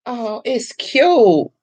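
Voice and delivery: high, drawn-out cooing; charmed, slow coo at the piece; delighted coo. Real, not acted. Admiring the piece again.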